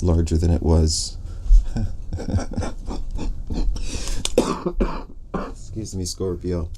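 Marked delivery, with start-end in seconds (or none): 1.2-5.3 long, breathy burst of laughter; helpless drawn-out giggling fit, rising higher; laughing. Real, not acted. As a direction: crack up and keep laughing. Bursts out laughing mid-reading.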